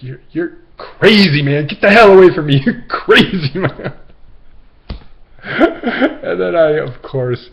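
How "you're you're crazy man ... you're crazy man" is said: loud, high mock yell; imitating a spooked, angry man; angry, rattled. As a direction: shout him off in alarm. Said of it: "He's like".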